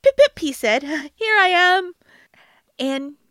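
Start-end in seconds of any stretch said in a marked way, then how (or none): 0.0-0.4 very high, loud piping call; high squeaky call. Acted. Boy calls from hiding. 1.2-1.9 excited. Acted. Boy pops out triumphant.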